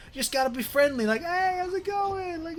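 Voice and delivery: high-pitched, badly imitated friendly greeting; terrible friendly impression. Retelling a movie's airport bit, badly impersonated. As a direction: put on a poor, high, chummy voice. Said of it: "That's a terrible impression".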